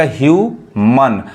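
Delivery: ह्यूमन said "hyoo-mun", very slowly; ह्यूमन said for the class; slow model word. Shown as a demonstration. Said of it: "अब यहाँ पे क्या पढ़ाएगा?".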